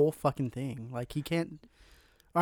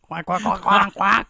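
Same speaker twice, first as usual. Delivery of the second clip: playful duck quacks, louder; duck quacking; 4 semitones higher, 8 dB louder, slower than usual. Pretend. Mocking duck lips with quacks.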